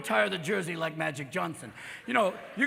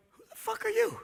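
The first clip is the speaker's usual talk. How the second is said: very high, startled mock squeak; morning-after shock. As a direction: squeak it in hungover horror. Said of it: "the morning after when you're going".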